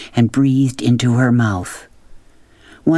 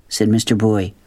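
plain reading voice

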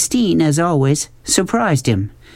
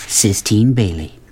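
ordinary delivery

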